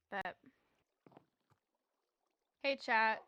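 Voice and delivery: ordinary talk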